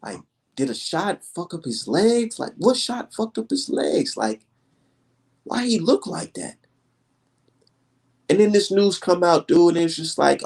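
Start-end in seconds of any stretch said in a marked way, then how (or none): 0.0-6.6 scratching his head over Wilder; puzzled wondering. Retold or imitated. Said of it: "I'm like".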